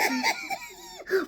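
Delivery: shrieking laugh of disbelief; delighted squealing laugh; high burst of laughter. Real, not acted. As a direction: break into a high giddy laugh. Laughing at finding key.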